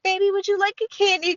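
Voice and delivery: put-on high-pitched sweet old lady. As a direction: offer it like a doting old lady. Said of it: "when old ladies are like".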